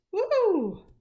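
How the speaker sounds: loud, high joyful cheer; girl cheering after winning; whooping. A narrator's reading. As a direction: whoop with victory. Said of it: "Imogene whooped".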